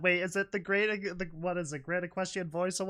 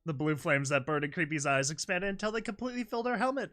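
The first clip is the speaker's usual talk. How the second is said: ordinary delivery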